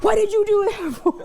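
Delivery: laughing squeaky impression of him; imitating upset husband. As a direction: mimic him whining at her, laughing through it. Imitates husband calling her upset.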